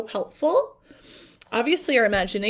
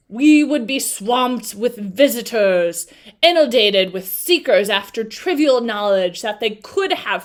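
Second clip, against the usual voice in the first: Sphinx voice; 6 dB louder than usual. Acted. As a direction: weigh every word like an old beast. Reads the Sphinx's lines.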